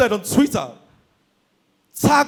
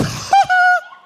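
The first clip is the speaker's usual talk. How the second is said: loud squealing laugh; shrieking laugh at the punchline; high burst of laughter; 5 dB louder than usual. Real, not acted. Big laugh at own joke.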